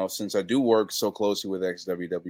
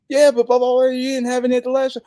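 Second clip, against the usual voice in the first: high, louder eager fan voice; imitating a fan; 13 semitones higher, 7 dB louder, faster than usual. A fan at the merch table, high eager mimicry. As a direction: mimic a pushy excited fan. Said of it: "you know".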